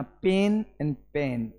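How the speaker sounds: two पेन sounds set side by side; contrasting pronunciation. Shown as a demonstration. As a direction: show पेन and पेन, lips rounded on one. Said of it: "एक में lips थोड़ा सा bubble होएगा".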